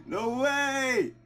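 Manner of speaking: high giddy laughter and joy; giddy laughing. Real, not acted. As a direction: laugh out the disbelief. Shiny reaction continues.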